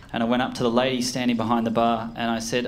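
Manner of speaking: ordinary delivery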